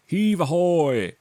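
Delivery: high, loud, drawn-out sailors' call; sailors' shout. Acted. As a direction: haul it out slow like sailors heaving chests. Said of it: "they shouted".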